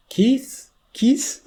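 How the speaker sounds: high, questioning call of a name. Retold or imitated. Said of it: "was like".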